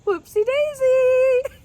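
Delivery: high, slow singsong "Whoopsie daisy"; playful high-pitched exclamation; sing-song whoopsie daisy. Acted. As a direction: stretch it out, way up high. Catchphrase sung out high.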